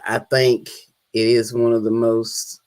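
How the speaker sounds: low, soft and measured; hushed, deep-pitched tone of admiration; sincere declaration. Real, not acted. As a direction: drop the voice low and quiet to state it sincerely. Solemnly declares love of Southern drawl.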